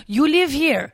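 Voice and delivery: put-on strange French accent; Spanish-Italian-French accent. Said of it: "a strange Spanish slash Italian French".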